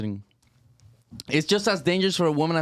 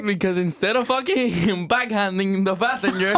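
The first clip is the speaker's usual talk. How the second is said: laughing; 4 semitones higher than usual. Real, not acted. Laughing while joking.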